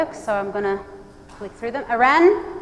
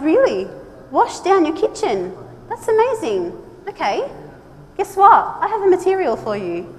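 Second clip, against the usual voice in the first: high, bright salesy excitement; hammed-up delight at the customer; playfully pitching the product, raised high; eager reply; 6 semitones higher than usual. Acts out her eager reply.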